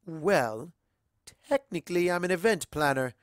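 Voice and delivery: a gracious society mare welcoming a stranger; Rarity, elegant and gracious. A narrator's reading. Rarity speaking.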